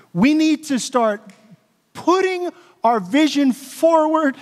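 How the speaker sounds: ordinary delivery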